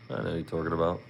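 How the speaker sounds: ordinary delivery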